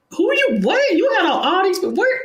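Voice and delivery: high-pitched stunned questions; mock disbelief. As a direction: sputter like you can't believe the list. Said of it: "y'all be like".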